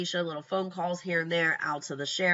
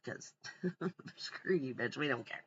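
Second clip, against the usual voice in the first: dismissive aside; 4 semitones lower, 11 dB quieter than usual. Real, not acted. Vlogger mocking Letitia to viewers, soft scornful laugh. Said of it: "muttered mocking aside".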